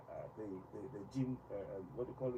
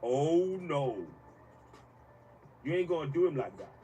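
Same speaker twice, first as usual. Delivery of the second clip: louder, indignant refusal; defiant protest; 3 semitones higher, 10 dB louder than usual. Real, not acted. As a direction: push back hard against the insult. Rejecting history sidelining him.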